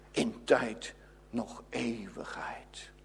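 slow, faint whispered close. Acted. Verdict on eternity whispered slowly to the congregation. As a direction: let it die to a slow whisper.

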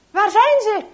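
high, forceful yelled question; shouting. A narrator's reading. Traitor shouts at silent Henk for an answer, shouting. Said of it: "schreeuwt hij nog een keer".

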